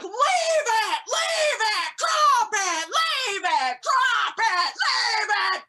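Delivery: high, loud frantic dog commands; screaming. Retold or imitated. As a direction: scream at the dogs to obey. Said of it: "we're all screaming".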